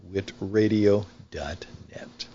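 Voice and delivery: ordinary talk, nothing marked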